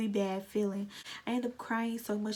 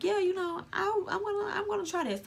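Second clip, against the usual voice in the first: excited reply; 10 semitones higher, 3 dB louder than usual. Retold or imitated. Retold eager reply.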